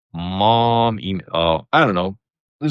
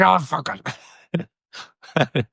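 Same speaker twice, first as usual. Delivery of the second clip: high-pitched mock rage from a little lady; comic little-lady outburst, breaking into laughter; tiny attacker impression; 10 semitones higher, much slower than usual. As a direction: imitate a tiny woman lashing out. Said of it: "She was really short. And she was like".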